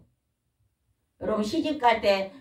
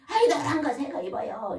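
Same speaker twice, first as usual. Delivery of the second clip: laughing, higher coaxing plea; coaxing wife; 6 semitones higher than usual. Retold or imitated. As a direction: wheedle her husband into the yellow clothes. Wife coaxing husband in story.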